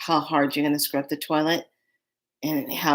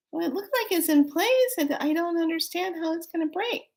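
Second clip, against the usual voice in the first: much higher, baffled self-talk; puzzled inner voice; 12 semitones higher than usual. Retold or imitated. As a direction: replay the puzzled thought in a higher voice. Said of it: "and I was like".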